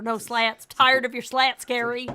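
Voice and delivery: loud fed-up scolding; scolding wife. Retold or imitated. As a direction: tell him off loudly. Voicing Sherry scolding.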